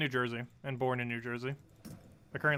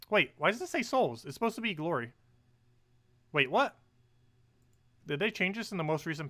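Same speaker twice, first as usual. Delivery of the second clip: surprised confusion; 6 semitones higher, 3 dB louder than usual. Real, not acted. Notices wrong reward in game.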